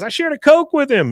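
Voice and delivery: high-pitched mock outrage; hammed-up horrified woman; appalled. Appalled lady accuses Bill.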